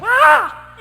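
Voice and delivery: high loud shriek; scream. Retold or imitated. Bride fleeing the old groom, sudden loud scream. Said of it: "She comes screaming down the step".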